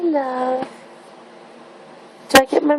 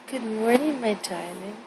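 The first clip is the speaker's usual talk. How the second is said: sweet nothings; 2 semitones lower, 9 dB quieter than usual. Real, not acted. Mother softly waking baby.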